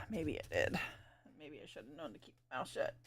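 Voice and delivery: fast unvoiced mutter; whispered aside. Real, not acted. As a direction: whisper it to yourself.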